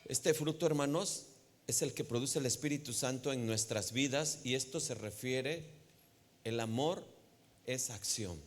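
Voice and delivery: normal talking voice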